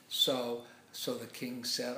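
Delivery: usual speaking voice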